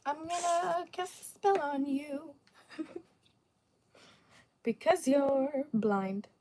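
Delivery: hammed-up slow witch curse. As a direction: curse them like a playful witch. Jokey spell threat at chatter.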